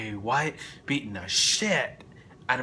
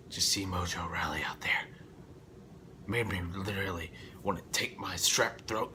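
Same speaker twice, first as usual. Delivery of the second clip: whisper; 4 semitones lower, 6 dB quieter than usual. Real, not acted. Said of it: "whispered aside".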